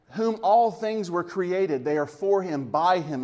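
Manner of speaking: ordinary talk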